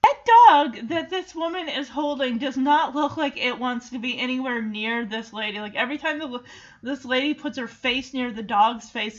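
ordinary delivery

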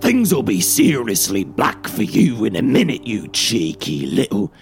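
furious copper in a gruff character voice; spluttering rage. A narrator's reading. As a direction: threaten them, spluttering with anger. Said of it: "as Anderson spluttered with rage".

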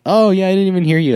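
high, loud imitation of pretended unawareness; feigned nonchalance. Chill drum teacher pretending not to have heard, imitated feigned nonchalance. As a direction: play the teacher covering for a student's blunder, brightly oblivious. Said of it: "he was really chill with me, pretended like".

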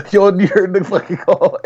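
laughing while talking; words pushed out through laughter. Real, not acted. Laughing at a funny moment.

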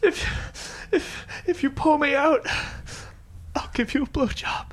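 high, strained pleading; breathless plea. Acted. Sinking man bargains with the third passerby, strained. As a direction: gasp out a last offer. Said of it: "And he says".

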